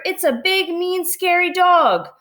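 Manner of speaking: high, frightened squeak of a burglar; a startled crook's high-pitched alarm; scared burglar. Acted. Scared burglar spots the dog.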